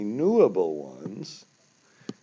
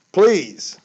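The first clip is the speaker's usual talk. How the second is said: much louder and higher pleading please; emotional plea; 11 semitones higher, 11 dB louder than usual. Real, not acted. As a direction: cry out the plea, really begging. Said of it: "Sorry, I got emotional about that".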